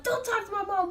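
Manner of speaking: put-on high, loud kid voice; defiant kid. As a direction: snap at the adults like a child.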